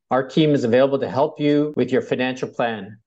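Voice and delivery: ordinary talk, nothing marked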